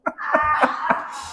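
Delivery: soft high chuckle; light airy laugh; high breathy laugh. Real, not acted. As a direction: laughs at his own punchline. After the joke's punchline.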